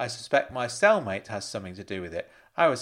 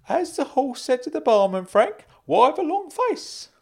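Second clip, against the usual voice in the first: much higher, louder put-on uncle voice; Uncle Claude telling a joke; 7 dB louder than usual. Uncle tells Frank a barman joke, high and hearty. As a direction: pitch it high, an uncle delivering a punchline.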